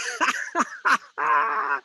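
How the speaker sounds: high jeering laugh; mocking crowd laugh. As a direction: laugh at him like a scoffer.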